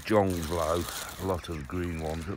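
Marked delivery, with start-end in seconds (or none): none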